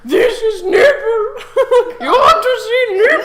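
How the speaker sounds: very high, loud whiny voice; whiny French lady. Pretend. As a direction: squeal it like a French madame.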